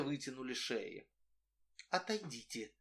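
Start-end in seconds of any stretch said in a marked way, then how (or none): 1.9-2.7 much softer, hushed request; quiet. A narrator's reading. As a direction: keep it barely above a breath. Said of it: "тихо сказала Сонечка".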